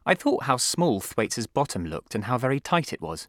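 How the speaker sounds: ordinary delivery, nothing marked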